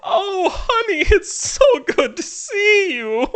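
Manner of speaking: very high, drawn-out gushing; Lady Cosmonauta. Acted. Society lady greeting her honey, high theatrical gush. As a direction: gush theatrically at a dear friend. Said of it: "It's Lady Cosmonauta".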